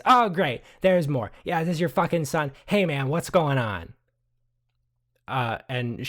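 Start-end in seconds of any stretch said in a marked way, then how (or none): 0.1-3.9 high, loud sneering outburst; sarcastic anger. Acted. Angry woman sneering at the son, loud. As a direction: spit it out, loud and high with contempt. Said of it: "the woman turns around and says".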